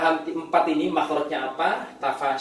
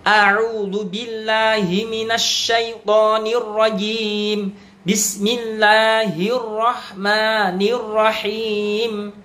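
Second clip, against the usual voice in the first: slow, melodic Arabic recitation; Quran recitation; 5 semitones higher, 3 dB louder, much slower than usual. Acted. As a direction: chant it slowly like tilawah. Recites ta'awudz and basmalah. (lantunan tilawah pelan)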